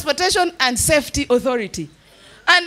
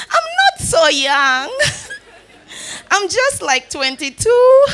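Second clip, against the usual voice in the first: giggly, slow, drawn-out mock bragging; coy laughing brag; 5 semitones higher, slower than usual. Comedian playing a coy young woman fibbing her age, giggling. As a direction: laugh through it, playing a coy twenty-something.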